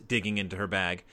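ordinary delivery

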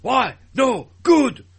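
loud, high mock German accent; Jake. Podcaster mimicking a castmate's drift, mock German bark. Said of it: "and he went German".

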